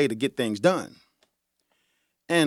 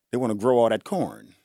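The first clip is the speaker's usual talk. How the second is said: usual speaking voice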